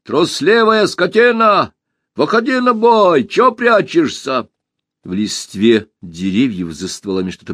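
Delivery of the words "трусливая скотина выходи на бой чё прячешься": challenging shout, raised high and loud. A narrator's reading. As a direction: yell a dare at the hiding beast. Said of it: "закричал".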